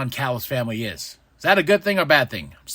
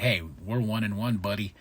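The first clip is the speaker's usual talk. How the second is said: much lower, quieter taunt; lightly voiced smug rival; 7 semitones lower, 9 dB quieter than usual. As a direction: needle him.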